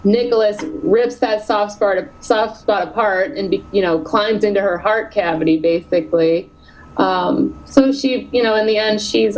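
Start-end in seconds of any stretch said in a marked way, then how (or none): none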